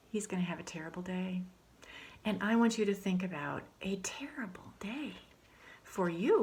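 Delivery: soft unvoiced whisper to the children; whispered aside. Teacher whispers aside to kids.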